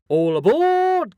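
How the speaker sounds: train conductor call. Pretend. Podcast sign-off catchphrase.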